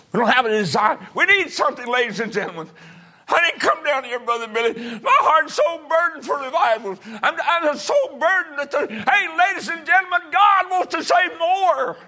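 loud, very high pulpit shout; fervent preaching. Real, not acted. Preacher pleads with the congregation for revival, shouting at full force. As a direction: holler your burden to the whole church.

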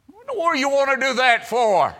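high, loud and fast; loud incredulous question. Acted. Preacher questioning the Spirit's suggestion, loud incredulous question. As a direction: throw it out as an exclaimed challenge.

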